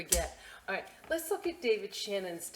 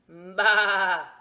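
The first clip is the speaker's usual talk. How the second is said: sheep bleat; 2 semitones higher, 15 dB louder than usual. Acted. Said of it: "said Sheep".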